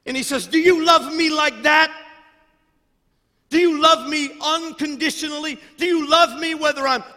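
loud, high, shouting sermon climax; building fiery sermon, shouting God's plea; preaching at full force. Acted. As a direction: preach it to the rafters.

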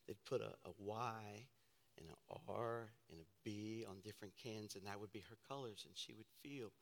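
low, soft storytelling; quiet reminiscence. Real, not acted. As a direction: recall a loved one in a hushed, tender voice. Quietly remembers great-grandmother.

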